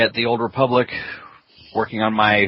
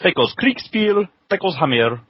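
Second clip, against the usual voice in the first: mock German greeting; 2 semitones higher than usual. Co-host's hammed-up German intro, in a put-on German accent. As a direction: greet the internet like a hammy German host. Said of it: "you want one, Full On?".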